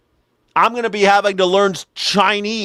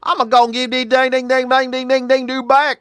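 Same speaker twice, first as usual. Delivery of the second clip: imitating country accent; 8 semitones higher than usual. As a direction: lightly ham up a rural Southern accent. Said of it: "guys that talk like this".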